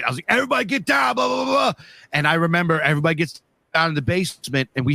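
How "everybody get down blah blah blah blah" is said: high-pitched yelled warning; retold shout. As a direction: shout the family down to the basement, high and urgent. Yelling warning in retold tornado.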